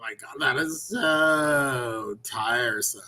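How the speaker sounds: very slow, stretched, theatrical drawl. Retold or imitated. Mocking affected speech with a slow, theatrical drawl. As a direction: drag every word out like a faded diva. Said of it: "And I'm not like".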